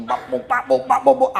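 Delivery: rapid punchy "bap bap" noises; tiruan bunyi latihan. Retold or imitated. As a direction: mimic the thumps of a hard workout. Imitates noise of working out hard. (tiruan bunyi hantaman, cepat dan tinggi)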